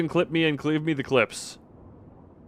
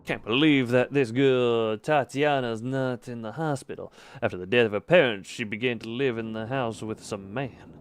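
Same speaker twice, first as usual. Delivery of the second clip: put-on Russian accent; 3 semitones lower than usual. Streamer reading a villager's note about Tatiana, put-on Russian accent. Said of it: "this is the accent for Russians".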